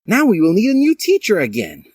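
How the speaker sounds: high, loud put-on curse; swearing. Professor Skellington swearing at the news, in a high squeaky voice. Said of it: "he swore".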